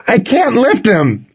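high, strained, drawn-out yell; cracking yell. A narrator's reading. Owner to friend beside the car, yelling helplessly. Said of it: "I yelled, my voice cracking".

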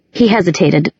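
ordinary delivery, nothing marked

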